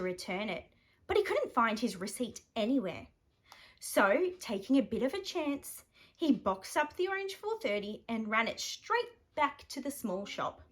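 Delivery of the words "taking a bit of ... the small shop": ordinary delivery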